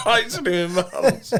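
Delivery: laughing through the words; cracking up mid-sentence. Real, not acted. Joke about his shrinking manhood.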